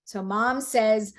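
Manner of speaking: ordinary delivery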